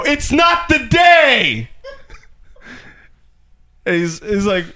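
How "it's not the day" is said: high, drawn-out defiant shout; hammed-up yell, stretching the words; obnoxious shout. Client yelling at his puzzled trainer, obnoxious shout. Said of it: "I was so obnoxious about it".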